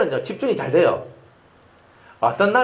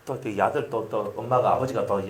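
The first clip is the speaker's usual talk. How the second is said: normal talking voice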